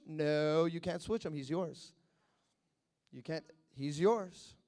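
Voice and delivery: low, quiet, final no; firm refusal. Acted. Answering a people who want to switch kings, low unyielding refusal. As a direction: refuse calmly, dropping low and soft.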